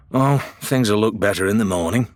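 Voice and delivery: plain reading voice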